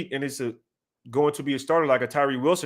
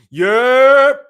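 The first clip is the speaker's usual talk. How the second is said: a sudden shouted yep, higher and louder; 6 semitones higher, 12 dB louder than usual. Real, not acted. Streamer shouts yep at his chat, loud and high. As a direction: blurt it out full volume.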